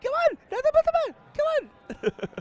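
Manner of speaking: squeaky falsetto, fast and loud; high voice. Pretend. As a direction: crank it up into a silly falsetto. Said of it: "With your high voice here".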